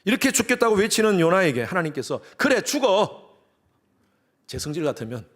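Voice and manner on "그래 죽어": high, loud, fed-up snap; exasperated retort. Acted. Preacher imagining a fed-up answer to Jonah's complaint, exasperated. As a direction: throw it back sharply, acting exasperated.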